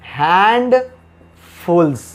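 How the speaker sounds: हैंडफुल्स stretched out very slowly; slow drill word. Shown as a demonstration. Teacher to students, drawing out हैंडफुल्स very slowly. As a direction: draw out हैंडफुल्स syllable by syllable. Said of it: "teacher drills the plural slowly".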